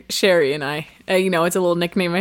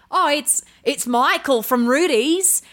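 high, sing-song fake Australian; broad, badly faked Australian accent; mock Australian accent; 6 semitones higher than usual. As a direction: demonstrate a hammed-up Australian voicemail greeting. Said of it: "just be like".

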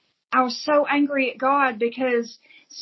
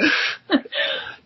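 laugh; 6 semitones higher than usual. Real, not acted. Laughing at own joke.

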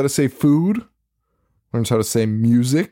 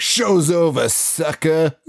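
higher, taunting brush-off; playful sassy voice, pitched up; mock sassy dismissal; 6 semitones higher, slower than usual. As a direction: snub the caller with a sneer. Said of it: "she picks up and is like".

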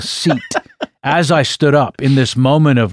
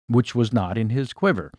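normal talking voice

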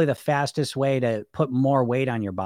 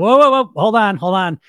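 loud, higher-pitched "hold everything"; retold emphatic interruption; 6 semitones higher, 8 dB louder than usual. Arguing back at own inner voice, emphatic objection. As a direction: cut in loudly to halt the argument.

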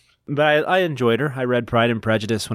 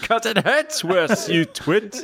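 higher, slow hammed-up villain impression; Alan Rickman; 9 semitones higher, much slower than usual. Reader joining in the Rickman teasing, slow mock impression. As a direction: drag out each word like a scornful movie villain. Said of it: "Is that how I do Rickman?".

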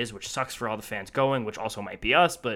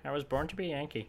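mocking quote; 10 dB quieter than usual. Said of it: "That's what they like to say".

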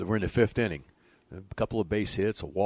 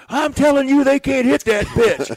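high, loud, slow stubborn insistence; insistent pitcher; 11 dB louder than usual. Retold or imitated. Young pitcher stubbornly telling his coach they can't hit it. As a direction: dig in and insist.

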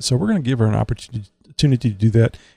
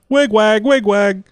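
high chanted wig wag; siren wig wag; 9 semitones higher, slower than usual. Shown as a demonstration. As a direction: chant it like flashing lights. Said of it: "That's when the lights blink".

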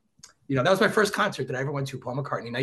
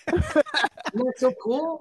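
higher, laughing as he asks, slow; giddy delight; 11 semitones higher, much slower than usual. Real, not acted. Shows off his card collection.

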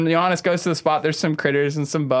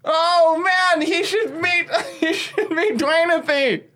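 high, slow, drawn-out enthusiasm; hammed-up eager squeal; gushing excitement; 12 semitones higher, slower than usual. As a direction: stretch the words with delight. Acted excitement at a new introduction.

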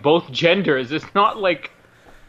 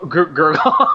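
drawn-out Canadian Gurgle, laughing; Canadian Google said Gurgle; mock Canadian accent; slower than usual. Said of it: "That's what Canadians say".

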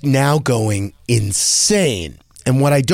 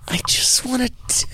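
a longing whisper, higher and much softer; whispered wish; 10 semitones higher, 5 dB quieter, faster than usual. Retold or imitated. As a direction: breathe it out as a secret wish to herself. Mom's wish amid crying son.

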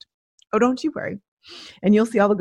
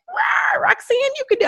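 high, squeaky mock cheer; cartoonish high-pitched nagging; mocking the pushy others. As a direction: mimic them egging her on in a silly high voice. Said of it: "And they were like".

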